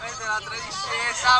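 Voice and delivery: high excited shout mid-drop; shouting on a log ride. Real, not acted. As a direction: yell it while plunging down. Dropping down the log flume.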